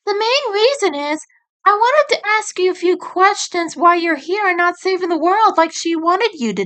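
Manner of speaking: put-on high young female rabbit voice, earnest; turning serious as the sister. Sister turns serious with Nick, high earnest voice. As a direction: get down to business. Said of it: "but then turned serious".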